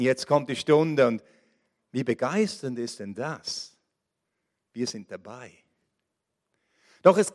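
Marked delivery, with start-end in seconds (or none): 0.0-5.5 much softer and lower, an intimate hush; hushed excitement. Acted. Preacher voicing the disciples' quiet hopes, hushed. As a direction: draw them in with a hushed voice.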